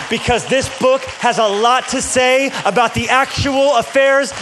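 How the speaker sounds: building sermon. Acted. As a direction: build the fire line by line until it peaks on raw. Preaching build to full force.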